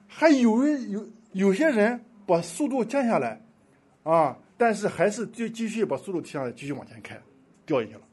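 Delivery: ordinary delivery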